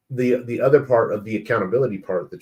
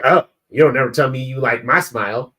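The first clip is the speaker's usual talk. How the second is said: louder, accusing mock-wife complaint; tone-deaf complaint; 3 semitones higher, 6 dB louder than usual. Wife to husband after he praises a stranger's smile, accusing. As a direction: imitate a wife's pointed, raised-voice gripe. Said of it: "my wife doesn't hear her say it to, to me like that".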